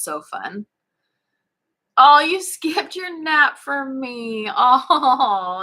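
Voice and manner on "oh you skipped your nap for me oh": high, slow, touched; delighted. Real, not acted. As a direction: gush at a kind viewer. Touched by viewer comment.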